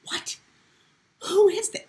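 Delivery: hushed "what", then very high-pitched question; mock disbelief. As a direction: act stunned, jumping into a squeak. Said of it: "I could not believe my mother was saying that".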